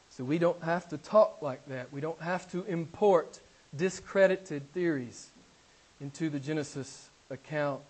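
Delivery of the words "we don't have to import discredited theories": ordinary delivery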